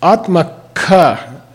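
Atmaka said very slowly, AHT-muh-kah; slow demo of Atmaka. Shown as a demonstration. Teacher modelling Atmaka slowly for the class. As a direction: stretch out Atmaka for students to hear. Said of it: "teacher modelling the word".

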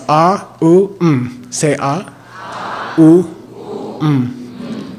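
A, U, M each sounded out separately; syllables of Om. Shown as a demonstration. Teacher sounding out A U M one by one. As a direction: break Om into its three sounds. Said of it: "Three parts".

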